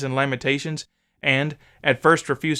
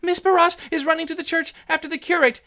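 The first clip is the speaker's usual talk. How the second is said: put-on much higher girl's voice; trembling servant girl. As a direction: answer shakily, beside herself. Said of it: "who was trembling and beside herself".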